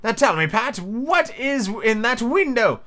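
high, stern character voice, drawn out at the end; high-voiced demanding master; Rabbit questioning. A narrator's reading. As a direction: interrogate the servant in a high voice, stretching the question. Rabbit questions servant Pat.

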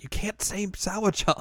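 high-pitched mock objection; Mia scolding. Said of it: "Mia's like".

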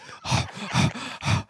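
theatrical heavy panting; panting breaths. Acted. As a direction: pant audibly, one breath after another. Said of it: "One is pants like the breath".

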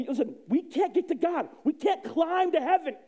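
ordinary talk, nothing marked

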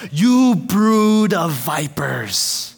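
slow, drawn-out denunciation; prophet's slow, weighty insult; John the Baptist rebuking. Acted. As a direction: stretch every word as a stern accusation. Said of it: "And then John says".

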